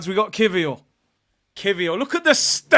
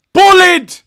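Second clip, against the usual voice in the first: shouted verdict; 9 semitones higher, 12 dB louder than usual. Real, not acted. Verdict on the defender.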